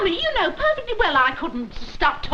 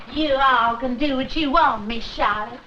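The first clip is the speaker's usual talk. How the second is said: put-on Southern accent. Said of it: "That's very good, [name]".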